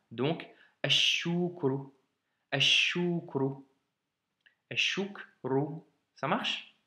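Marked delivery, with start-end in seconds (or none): 0.8-5.8 "ashukru" slow, doubled sh, no L; slow model reading. Shown as a demonstration. Teacher repeats "ashukru" slowly for a student. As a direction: stretch "ashukru" with a long sh. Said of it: "le shin a une shadda".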